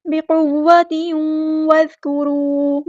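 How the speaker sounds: slow, drawn-out recitation; slow Quranic recitation. Shown as a demonstration. Teacher reciting verse words slowly for students. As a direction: stretch every vowel as in tajweed.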